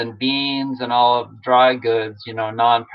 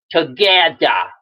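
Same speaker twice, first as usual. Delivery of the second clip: "Together" said slowly as "Tagetha", r dropped; drawn-out Northeastern "Together", no final r; Bernie's "together"; slower than usual. Shown as a demonstration. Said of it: "if you say it like Bernie".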